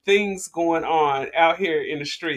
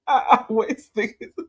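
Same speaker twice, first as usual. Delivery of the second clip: laughing; 8 semitones higher, 3 dB louder than usual. Real, not acted. Laughing at the clip.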